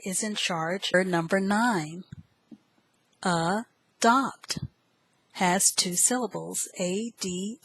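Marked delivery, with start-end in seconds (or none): 3.2-4.6 "adopt" split into a, then dopt; syllable by syllable. Shown as a demonstration. Teacher introduces word nine, "adopt" broken in two. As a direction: say "adopt" in two parts with a pause.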